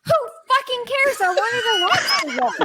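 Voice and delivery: high-pitched, indignant venting; comically outraged complaint; exasperated outburst. Retold or imitated. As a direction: blurt it out, fed up, pitch jumping up. Outburst over blocked lobster roll.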